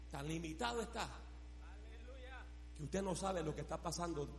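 low, soft explaining; subdued preaching. Real, not acted. Preacher contrasting human limits with God, subdued preaching. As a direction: drop the voice right down after the shout.

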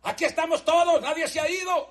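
preacher acting out Paul's cry; Paul shouting. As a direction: call out across the dark prison to save him. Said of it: "Pablo gritó y dijo".

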